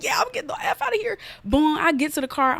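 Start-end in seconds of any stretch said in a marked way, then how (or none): 0.0-1.2 very high, quick squeal, retold; comic high-pitched retort; mock outburst. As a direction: squeak it out fast as a hammed-up exit line. Acts out gleefully storming out after dismissal.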